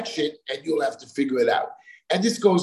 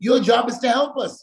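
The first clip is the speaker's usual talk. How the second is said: high, fast retold retort; firing his point back much higher; heated retort; 8 semitones higher, much faster than usual. Telling the inspector off, high and fast.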